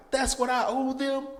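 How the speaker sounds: horrified by the number; shocked. Retold or imitated. Terrified client asking the tax lady about the total, shocked.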